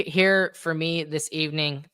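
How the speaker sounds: ordinary talk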